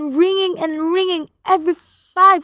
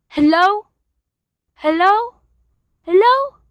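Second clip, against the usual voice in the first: each hello higher and louder into the dead line; calling out more urgently each time, building from mild to loud; rising anxious hellos; 4 semitones higher, 5 dB louder than usual. Acted. Invalid woman calling into a dead phone, building anxious hellos.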